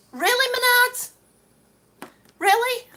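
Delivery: high, loud incredulous challenge; indignant disbelief. Real, not acted. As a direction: call the store out, aghast. Outraged at broken flange.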